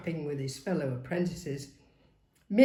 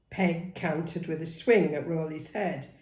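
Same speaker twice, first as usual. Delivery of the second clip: usual speaking voice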